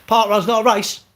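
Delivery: loud, high sarcastic parroting; mock the official line; scoffing imitation of the organisers; mocking quote. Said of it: "Yeah, good luck".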